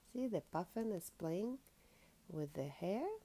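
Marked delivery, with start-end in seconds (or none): none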